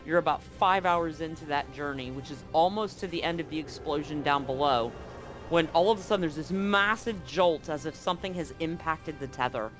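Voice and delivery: normal talking voice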